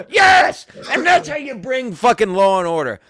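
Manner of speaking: high, loud victory yell; triumphant shout. Real, not acted. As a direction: crow in triumph at full volume. Triumphant end of altercation story.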